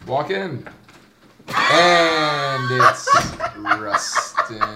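big drawn-out show introduction; announcer shout. Pretend. Hyping Rustin as he walks in, announcer shout. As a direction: holler it like a ring announcer, laughing.

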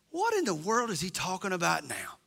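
a hint of snide Israelite; sarcastic. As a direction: grumble it under your breath. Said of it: "that element of sarcasm".

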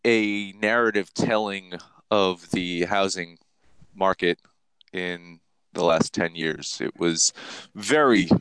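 low, slow, measured radio delivery; slow and low like a public radio host; calm NPR voice. Pretend. To podcast listeners, mock public radio calm. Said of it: "my calm Max voice".

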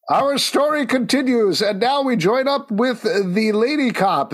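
high, grand mock narrator voice; hammed-up Doctor Fate intro; Doctor Fate narration. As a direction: announce it like a portentous comic narrator. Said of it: "Doctor Fate being like".

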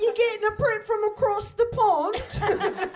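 put-on high, slow, laughing British accent; hammed-up British, loud and drawn out; mock British accent. Host teasing British listeners about a print, hammed-up British accent. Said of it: "We end up always doing accents".

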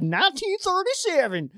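put-on high, old newsreel announcer voice; old-timey voice. Shown as a demonstration. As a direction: say it like a 1930s radio man. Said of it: "I do, like, old-timey voice".

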